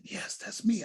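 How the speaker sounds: breathy whispered reply, no voice; whispering. Retold or imitated. Deacon answering a student's late-night call, whispering. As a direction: whisper it back as if on a secret call. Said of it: "I whispered back".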